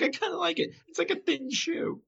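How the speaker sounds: a pleased little Larry, pitched high. Retold or imitated. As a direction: squeak it out like a smug Larry. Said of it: "He's like".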